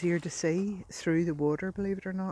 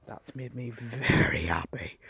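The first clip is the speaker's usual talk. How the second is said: happy whisper; 5 semitones lower, 5 dB louder than usual. Real, not acted. Delighted with find.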